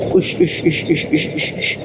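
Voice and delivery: breathy whoosh imitating gusts; whooshing wind noise. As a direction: blow out like the wind. Mimicking the gusting wind. (tiruan suara angin berdesir)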